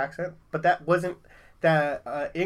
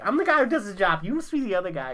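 Wahlberg impression; 6 semitones higher, 4 dB louder than usual. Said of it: "Like Mark Wahlberg in, uh, Departed".

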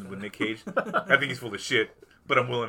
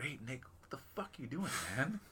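hushed friend; 4 semitones higher, 10 dB quieter than usual. Retold or imitated. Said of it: "like Robert Redford".

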